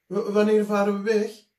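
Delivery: high, loud stammered question; eager stammering child. A narrator's reading. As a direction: blurt it out, tripping on the first word. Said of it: "vroeg Dikke Druif". (Dikke Druif vraagt gretig en hakkelend, hoog en luid)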